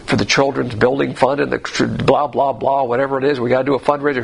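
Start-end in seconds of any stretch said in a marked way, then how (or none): none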